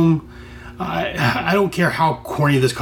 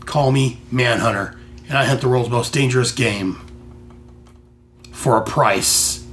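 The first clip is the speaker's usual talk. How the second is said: low, lightly dramatic bounty hunter; 4 semitones lower than usual. Acted. Manhunter introducing himself to the cops and crook, mock-dramatic low voice. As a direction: give a measured comic-book hero introduction, slowing at the end.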